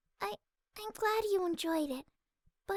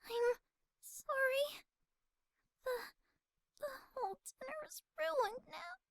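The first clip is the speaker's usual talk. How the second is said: higher, on the edge of tears; voice shaking; 5 semitones higher than usual. Acted. Fluttershy near tears over the ruined dinner. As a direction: hold back tears as you speak. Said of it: "Her voice shook as she tried to hold herself back".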